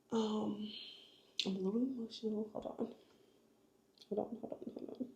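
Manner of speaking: hushed and fading, fighting off tears; holding back tears. Real, not acted. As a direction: pull yourself together under your breath, near crying. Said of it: "I'm trying not to cry".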